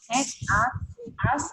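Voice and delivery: "ask" as "askt", ending in T; teacher's model of "ask" with a KT ending; final T sound. Shown as a demonstration. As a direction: release a T after the K in "ask". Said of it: "Đọc là T, vì thế sẽ đọc là ask".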